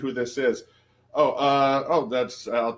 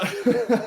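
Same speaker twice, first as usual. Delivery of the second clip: giggle; 8 semitones higher than usual. Real, not acted. Guest laughs before starting act.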